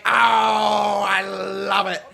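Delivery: mock English accent, very slow and shouted. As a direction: imitate a Brit gushing, stretched out. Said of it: "in his English accent that he has".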